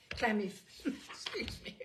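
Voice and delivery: ordinary delivery, nothing marked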